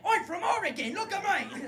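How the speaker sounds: high, loud mocking Oregon accent; hammed-up Oregon newcomer voice. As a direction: start a shouted Oregonian caricature. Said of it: "somebody who moved from".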